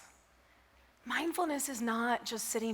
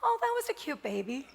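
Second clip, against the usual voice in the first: gentle, pleasant and a touch higher; a calmed man speaking kindly to the clerk; calm friendly remark; 5 semitones higher than usual. Retold or imitated. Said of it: "he said".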